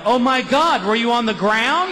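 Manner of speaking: high, alarmed voice; shocked wife. Retold or imitated. Wife on the phone, high and alarmed. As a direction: gasp out the question.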